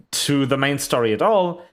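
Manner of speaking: ordinary talk, nothing marked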